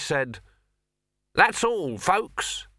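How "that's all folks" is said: high, cheery cartoon sign-off; bright cartoon sign-off. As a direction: sing it out like a cartoon ending. A strange voice from the machine.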